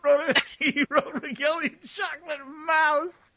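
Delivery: high, loud, tearful laughter through the words; real hysterics at a silly typo; cracking up. Real, not acted. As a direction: barely get it out for laughing.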